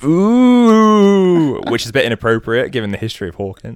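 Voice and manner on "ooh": high, loud ghostly ooh; spooky ooh. Acted. Joyce teasing the boys about spooky night, ghostly ooh. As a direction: wail it like a ghost. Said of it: "And she goes".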